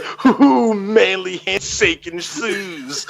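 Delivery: slow, stretched dude-bro whoop; hammed-up goofy bro imitation.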